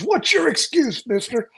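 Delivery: high-pitched mock demand; mock yelling. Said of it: "started yelling at me".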